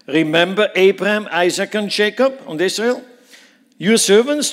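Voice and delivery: loud, high preaching of the promise; fervent reminder. Acted. Moses reminds God of his oath: loud, fervent. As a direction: press the promise home with full force.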